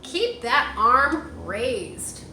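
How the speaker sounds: slow, gentle storybook read; reading to children. Acted. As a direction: read it like a picture book to little ones. Reading picture book aloud.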